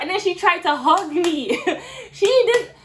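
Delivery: high, loud, laughing disbelief; real laughter bursting through, scandalized; incredulous laughing. Real, not acted. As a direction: tell it breaking into a laugh, pitch soaring. Incredulous about being hugged.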